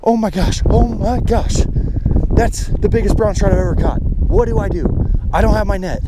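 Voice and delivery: high, loud and overwhelmed; real frantic excitement. Real, not acted. Angler with record trout and no net, frantically excited. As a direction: cry out, thrilled and panicking.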